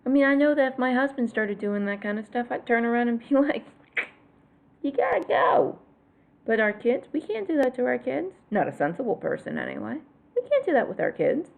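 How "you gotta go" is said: mock dismissal. Mock telling husband to leave.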